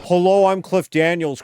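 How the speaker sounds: a loud, hammed-up hello as a race crew chief; Cliff Daniels impression. A streamer greets chat as the crew chief, loud mock voice. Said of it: "the worst Cliff Daniels impression ever".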